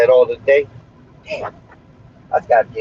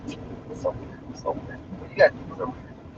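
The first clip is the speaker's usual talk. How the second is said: breathy unvoiced whisper; whispered aside about weird people; 3 semitones higher, 14 dB quieter than usual. Real, not acted. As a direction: mutter it under the breath.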